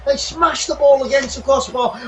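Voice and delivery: ordinary delivery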